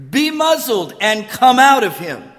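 stern rebuke. Acted. Jesus rebuking the demon in the synagogue, forceful command. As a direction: order it out with force. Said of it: "Jesus rebuked the demon".

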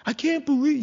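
mock complaining. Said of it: "we call it venting".

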